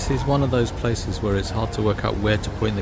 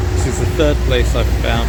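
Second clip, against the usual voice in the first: raised voice over background din; yelling over noise; 2 semitones higher, 9 dB louder than usual. Real, not acted. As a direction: push the voice over a loud machine. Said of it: "sorry about the noise".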